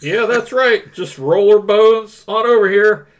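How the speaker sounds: a hint of a nasal principal; lightly voiced smug school principal. Reads principal Rooney role.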